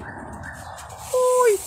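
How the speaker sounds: high, tiny kitten voice. A narrator's reading. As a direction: squeak it out like a small kitten. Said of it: "exclamó".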